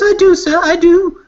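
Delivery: frightened Birdie. Acted. Birdie, grabbed by the ear, squeaks a panicked yes. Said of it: "He says".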